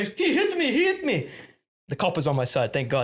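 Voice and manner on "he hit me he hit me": high, overacted wail of complaint; hammed-up outraged victim, pitched way up; mock victim's cry. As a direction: play up a fake injury like a flopping footballer. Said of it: "he was the best actor I've ever met".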